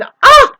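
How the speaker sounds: high, loud squeal of delight; excited squeal. Real, not acted. Thrilled by the idea, a high loud squeal. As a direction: let out a high cry of excitement.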